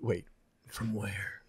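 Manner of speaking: a quick breathless whisper aside. Real, not acted. Cohost whispering a joke aside during a letter reading. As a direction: whisper it under the others' talk. Joking aside about listener's question.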